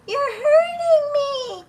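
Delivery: put-on very high, slow, tearful child's plea; imitating a crying little girl. Retelling a recording, voicing the crying little girl. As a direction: wail it like a hurt little girl. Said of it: "after the little kid goes".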